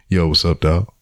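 low, subdued imitation of a cool hello; unenthusiastic greeting. Brother greeting him differently this time, subdued. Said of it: "he wasn't like that this time".

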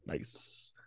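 soft whispered echo. Real, not acted. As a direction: whisper it back. Echoing the other's whisper.

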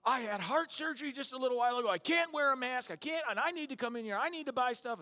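high, fast, loud ranting; angry customer. Retold or imitated. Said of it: "the guy is just going nuts".

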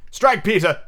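high, firm command. A narrator's reading. Toodles baring his breast to Peter, firmly. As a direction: deliver it as a resolute order. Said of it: "he said firmly".

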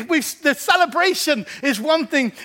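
ordinary talk

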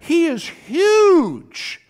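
high, slow, loud gasp of awe at the size; awestruck. Acted. To the congregation about Goliath, awestruck and drawn out. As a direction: stretch it out like you can't believe how big he is. Preacher acts awe at giant.

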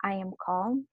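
softly repeating I am calm to herself; quiet self-calming mantra. Retold or imitated. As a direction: murmur it under her breath. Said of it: "and I'm like".

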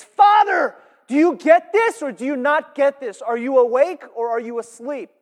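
high, loud pleading shout that shakes him awake; urgent shout. Retold or imitated. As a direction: cry out to wake a sleeping man. Tamar confronts Judah.

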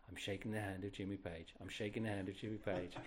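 a touch of hushed disbelief in his head; awed inner voice. Retold or imitated. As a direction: say it to yourself in awe. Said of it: "in my head going".

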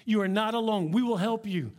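ordinary delivery

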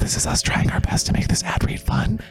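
hushed apology to the listeners; whispered aside. Real, not acted. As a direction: step out of the bit and whisper.

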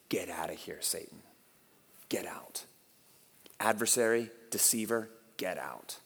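low, very soft command; quiet rebuke. Retold or imitated. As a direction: dismiss him under your breath, low and controlled. Jesus quietly rebukes Satan.

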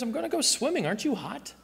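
high, light imitation of an oblivious friend; carefree reply. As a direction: play the innocent, pitched well up. Acts out carefree swimmer answering friend.

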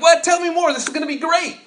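very high, loud hammed-up gush; giddy eagerness. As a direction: play the clueless eager friend. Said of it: "And you're like".

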